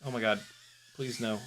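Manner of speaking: ordinary talk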